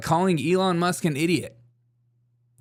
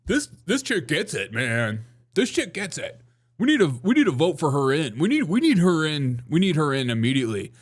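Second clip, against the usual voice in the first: mock Democrats; 2 semitones higher than usual. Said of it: "Democrats are like".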